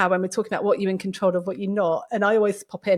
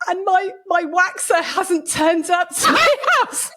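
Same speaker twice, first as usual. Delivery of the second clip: laughing mock sob; 9 semitones higher, slower than usual.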